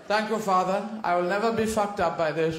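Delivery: lightly voiced posh boy, laughing through it; a prim little aristocrat thanking his father, laughing; mock posh child. Young aristocrat thanks his father for the blooding, mock prim.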